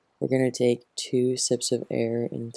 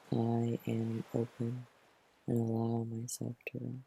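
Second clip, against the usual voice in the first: hushed intention; 9 dB quieter than usual. Acted. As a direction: let the repeated intention sink almost to a murmur. Last repeat of the intention.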